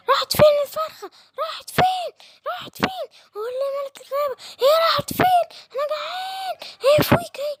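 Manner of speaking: very high, crying demands; a starving camel wailing for his dinner; whining hungry camel. Acted. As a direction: camel asking the lion where the hen went. Hungry camel hunting the chicken.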